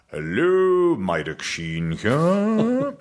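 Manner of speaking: high, very slow put-on posh Welsh dad; dad's posh voice. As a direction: draw it out like a Welshman faking posh. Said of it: "he puts on a rather posh voice".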